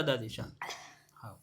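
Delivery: hushed and gentle, settling her down; soothing whisper. Real, not acted. Interviewer hushes crying grandmother, whispering. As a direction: whisper softly to calm her.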